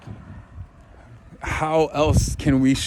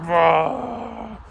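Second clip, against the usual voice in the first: a much higher, booming "Rah!" roar; powerful roar; 11 semitones higher than usual. Retold or imitated. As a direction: roar like your mighty higher self. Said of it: "my higher self where he was like".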